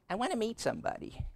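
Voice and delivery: high, fast mock plea of a lonely churchgoer; mock congregant voice. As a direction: play the lonely single asking for help.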